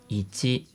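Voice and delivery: softly said "Ichi", stepping upward; rising Japanese pitch. Shown as a demonstration. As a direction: lift the second syllable of "Ichi". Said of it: "muchas palabras que son así".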